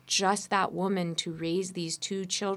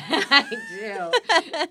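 high, louder, laughing admission; laughing yes; 8 dB louder than usual. Real, not acted. Admitting to the host she loves sweat, laughing. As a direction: laugh it out, guilty and delighted.

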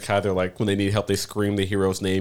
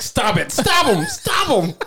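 rising, much higher pleas; a panicked inner voice climbing higher and higher; squeaky chromosome voice; 5 dB louder, slower than usual. Pretend. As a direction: voice his chromosomes begging him to stop. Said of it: "my chromosomes are like".

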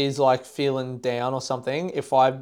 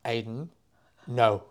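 retold girlfriend's reaction; 2 semitones lower, slower than usual. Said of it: "and she was like".

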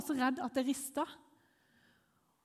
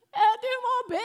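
high, loud mock-crying plea; hammed-up tearful begging; re-enacted bawling; 12 semitones higher, 9 dB louder than usual. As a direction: wail it out through pretend tears. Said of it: "Jeg, jeg bare grein og grein".

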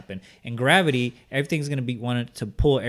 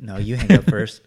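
teasing lovers' reply, louder; giggly late night call; 9 dB louder than usual. Pretend. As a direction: play the sappy partner refusing to hang up. Couple-style call ending mock.

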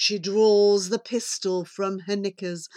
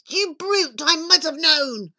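raised, high pig voice; affronted little pig; indignant pig; 5 semitones higher than usual. Acted. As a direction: cry out in piggy outrage. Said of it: "Pig cried".

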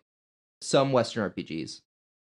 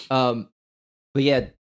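normal talking voice

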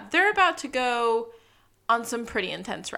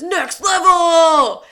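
slow, much louder stretched-out cry; thrilled yell; 7 semitones higher, 13 dB louder, much slower than usual. Retold or imitated. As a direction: holler it like the ride of your life. This pandemonium.

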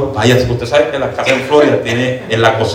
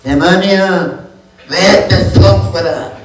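put-on shrill-free high churchy old lady voice, laughing through it; old woman rebuking demon; 8 semitones higher, much slower than usual. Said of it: "y oímos la viejita".

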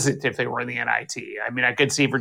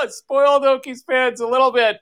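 laughing; 11 semitones higher than usual. Real, not acted. Laughs at his own remark.

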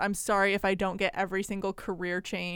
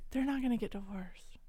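whispered reassurance; 10 dB quieter than usual. Real, not acted. Teasing reassurance.

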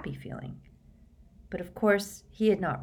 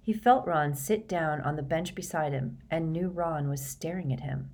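ordinary delivery, nothing marked

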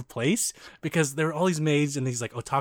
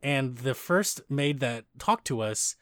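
ordinary talk

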